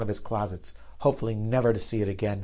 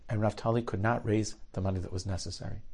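usual speaking voice

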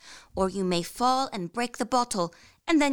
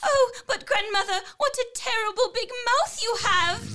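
put-on high, alarmed child's cry; frightened girl; 10 semitones higher than usual. A narrator's reading. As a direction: cry out in rising fear.

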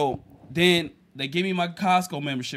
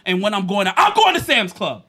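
defiant parting shot; 2 semitones higher, 7 dB louder than usual. Retold or imitated. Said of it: "and say".

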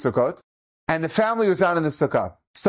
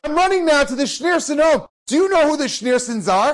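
high, raised-voice interrogation; acted exasperated uncle; angry scolding; 12 semitones higher, 4 dB louder than usual. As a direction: demand an answer from the kid. Furious uncle scolds nephew.

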